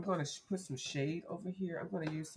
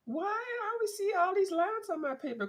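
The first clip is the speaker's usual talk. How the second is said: mock whiny complainer; 6 dB louder, faster than usual. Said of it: "'Cause you're sitting there".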